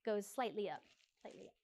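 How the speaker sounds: ordinary delivery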